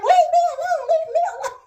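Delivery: high, loud pleading wail; mother in labour begging to be left alone; desperate plea. Retold or imitated. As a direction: beg them to stop.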